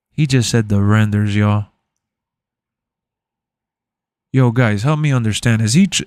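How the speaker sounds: very low, mock-serious bass voice. Streamer dropping his voice low to mock a viewer's complaint.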